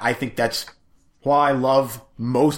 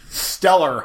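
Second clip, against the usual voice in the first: ordinary talk, nothing marked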